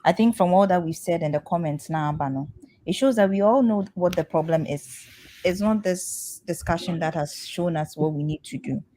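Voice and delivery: ordinary delivery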